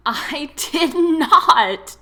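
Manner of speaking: high, loud, drawn-out protest; protesting through laughter. A narrator's reading. Daphne denies it to Tom, protesting through laughter. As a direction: stretch each word in giddy indignation. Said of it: "Daphne protested through her laughter".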